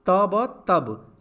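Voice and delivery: ट, ब, then टब, each sound drawn out and loud; spelled out slowly. Shown as a demonstration. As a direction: build टब sound by sound. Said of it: "तो क्या बनेगा?".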